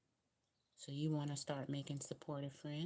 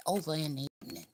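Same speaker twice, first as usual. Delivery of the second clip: southern accent; 7 dB louder, much faster than usual. Shown as a demonstration. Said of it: "My southern accent".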